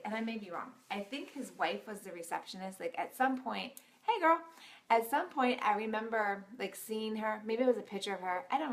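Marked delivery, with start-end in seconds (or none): 4.0-4.4 chirpy greeting. Retold or imitated. Imitating counselor greeting wife.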